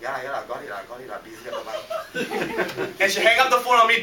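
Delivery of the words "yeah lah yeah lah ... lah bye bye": mock busy wife, low and muttered; busy brush-off. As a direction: brush the caller off under your breath. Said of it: "very busy tone".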